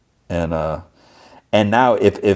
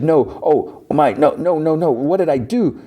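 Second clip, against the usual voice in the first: high, breaking cries of no; horrified at what she has done; distraught panic; 8 semitones higher than usual. Acted. Riri sees her injured arm.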